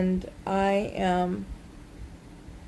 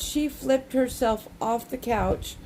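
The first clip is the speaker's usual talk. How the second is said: ordinary talk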